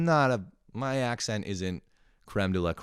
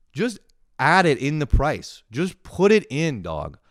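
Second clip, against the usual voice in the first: slow, loud, higher comic exasperation; exasperated; 5 semitones higher, 6 dB louder, slower than usual. Acted. Customer fed up with tip question, slow exasperation. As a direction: spell it out slowly in loud frustration.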